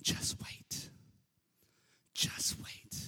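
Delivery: breathy hushed whisper, barely voiced; soft gentle whisper. Preacher recalls God's voice in prison as a soft gentle whisper. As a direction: whisper it gently like a soothing inner voice. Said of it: "it was subtle, it was soft, it was gentle".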